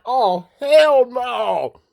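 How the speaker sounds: slow, stretched-out "Oh, hell no"; drawn-out disbelief. Retold or imitated. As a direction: draw it out in stunned disbelief. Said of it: "I was like".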